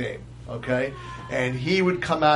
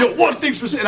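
high-pitched catchphrase in someone's face; mock confrontational patter; imitating the inmate; 6 semitones higher than usual. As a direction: deliver it high, mimicking the in-your-face inmate. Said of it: "he's in the guy's face".

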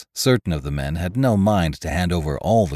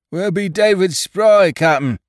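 speaking out; 13 semitones higher, 6 dB louder than usual. A narrator's reading. One of the stranded men challenges the captain, speaking out. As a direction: call it out high to the captain. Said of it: "spoke out one of the men".

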